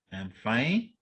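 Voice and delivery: "fine" lifted uncertainly; unstated reservation. Shown as a demonstration. Teacher demonstrating hidden doubt, unstated reservation. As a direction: say it unsure, rising on "fine". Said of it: "not so certain whether you are fine".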